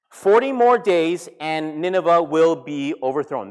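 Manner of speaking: loud, slow declaration; booming, drawn-out prophet's warning; prophetic proclamation. Retold or imitated. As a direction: proclaim it to a whole city, word by word. Repeats Jonah's sermon to Nineveh.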